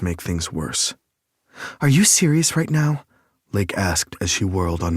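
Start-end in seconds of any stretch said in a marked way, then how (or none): 1.8-3.0 higher, louder, sharp female challenge; indignant heroine, raised and higher; Lake's voice. A narrator's reading. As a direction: whirl on him with an indignant question. Narrator voicing Lake, angry.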